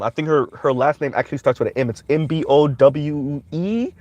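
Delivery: ordinary talk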